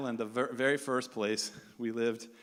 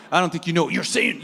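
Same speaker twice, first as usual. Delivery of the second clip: fast, louder sassy parroting back; mocking echo; 4 semitones higher, 8 dB louder, faster than usual. Echoing the local man's words back at him, mocking and fast. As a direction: throw his words right back at him, quicker. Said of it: "And I'm like".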